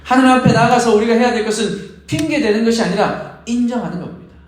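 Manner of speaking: normal talking voice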